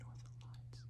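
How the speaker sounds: breathy whispered echo of Northern Lights. Shown as a demonstration. Host echoing the strain name as a quiet whispered aside. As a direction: drop to a hush and repeat Northern Lights. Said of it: "Did you say Northern Lights?".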